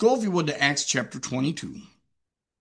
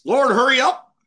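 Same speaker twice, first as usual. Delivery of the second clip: mock impatient plea; 9 semitones higher, 7 dB louder than usual. Imagined impatient prayer of Saul.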